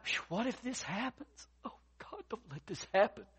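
mock late-night worry, part whispered; worried. Worrier pleading with God at night, anxious and hushed. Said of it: "How much time have we lost worrying".

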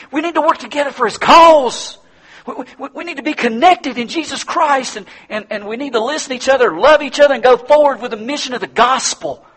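high, loud impassioned preaching; rally the congregation with fire; preacher pleading with his flock, high and loud; fervent preaching. Acted. Preaching at full force.

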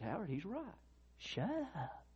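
soft, high, gentle correction; a still small voice, not a shout; quiet inner voice. Retold or imitated. God speaks quietly to Howard.

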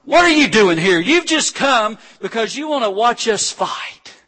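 a hint of a scolding older brother; scornful big brother. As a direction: brush off the little brother with scorn. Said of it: "His brother Eliab's like".

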